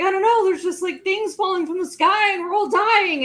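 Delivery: high-pitched mock panic; played-up, high-strung alarm; freaking out. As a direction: freak out as a hammed-up bystander, pitch way up. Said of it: "like, freaking out".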